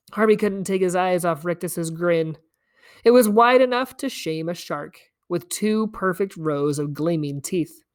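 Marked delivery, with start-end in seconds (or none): none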